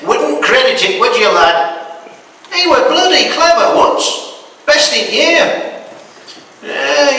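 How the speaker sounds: a hint of an old northern grandad spinning a yarn; old Yorkshire granddad. Granddad tells his grandson about Abner, lightly voiced.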